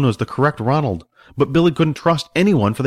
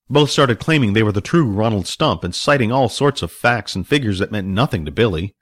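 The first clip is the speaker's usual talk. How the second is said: ordinary delivery, nothing marked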